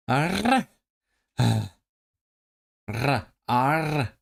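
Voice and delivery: drawn-out trilled pirate "arr"; pirate growl. Shown as a demonstration. Streamer trying out a pirate sound for chat, trilled growl. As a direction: practise a rolling pirate arr. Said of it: "I'm just doing a pirate sound".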